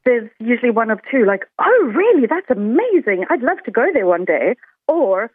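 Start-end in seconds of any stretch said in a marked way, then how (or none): 1.6-4.5 high, loud burst on "oh, really", then easing; gushing amazement. Retold or imitated. Quoting locals who learn she's South African, gushing mock amazement. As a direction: imitate an enthusiastic, amazed reaction. Acts out typical excited reaction.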